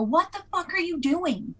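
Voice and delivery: high-pitched exasperated demand; acted angry confrontation. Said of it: "shove them and go".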